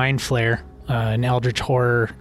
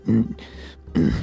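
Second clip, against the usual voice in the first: dragon growl; 6 semitones lower than usual. Acted. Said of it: "as he goes".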